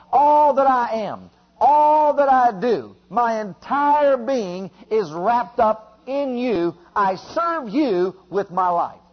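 loud, rising prayer at full force; fervent preaching. Acted. Preacher modeling a prayer for the church, fervent and loud. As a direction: pray it out with mounting fire.

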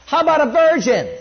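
preacher shouts "How about a virgin?" to stun the crowd; emphatic shout. Real, not acted. Preacher repeats the question to the congregation, shouting.

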